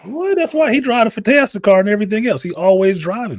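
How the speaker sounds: high and fast, suddenly getting it; excited realization. Real, not acted. Excited realization about Ben.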